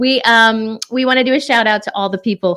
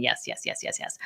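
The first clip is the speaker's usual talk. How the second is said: barely audible whispered yeses; 14 dB quieter than usual. Real, not acted. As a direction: whisper to yourself while reaching. Grabbing phone with foot.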